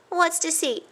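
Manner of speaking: high, wondering mouse voice; curious. A narrator's reading. A curious cousin asks innocently, high. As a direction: ask innocently. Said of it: "asked Ced curiously".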